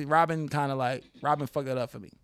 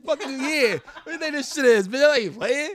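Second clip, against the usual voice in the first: mock angry player; 11 semitones higher, 5 dB louder than usual. Acting out the player's anger.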